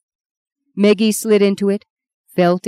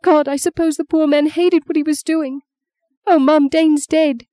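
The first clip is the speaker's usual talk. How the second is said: much higher, anguished voice; tearful grief; 10 semitones higher than usual. Acted. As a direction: lament to mum through distress. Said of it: "Maggie heard her sob".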